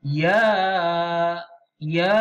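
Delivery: Ya held for four beats; long vowel. Shown as a demonstration. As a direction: stretch Ya long, four harakat. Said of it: "Jadi kita baca". (Ya dibaca panjang empat harakat)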